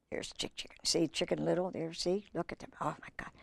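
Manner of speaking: breathy whisper to the listener; soft murmured aside over the picture book; hushed aside. Real, not acted. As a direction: lean in and whisper it.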